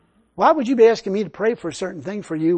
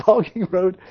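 usual speaking voice

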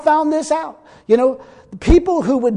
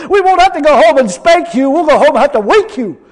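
laughing; 3 semitones higher, 7 dB louder than usual. Real, not acted. Joke told laughing.

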